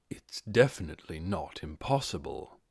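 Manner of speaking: lightly voiced lord musing. A narrator's reading. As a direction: think it over darkly to himself. Ainz muses to himself.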